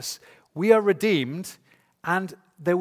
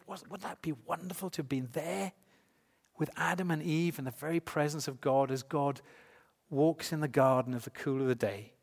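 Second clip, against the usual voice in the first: soft, wondering inner thought; hushed wonder; 6 dB quieter than usual. Acted. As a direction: muse it softly, as if imagining being there. Voices a reader's wistful thought.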